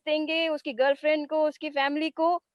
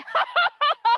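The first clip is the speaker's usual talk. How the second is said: high mocking laugh; a burst of scornful laughter; 12 semitones higher than usual. Real, not acted. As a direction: laugh right at them.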